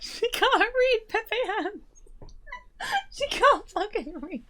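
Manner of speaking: very high, squeaky words through laughter; helpless laughter. Real, not acted. Co-host teasing the host's failed reading, helplessly laughing. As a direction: wail it through a laughing fit. Co-host losing it over misreading.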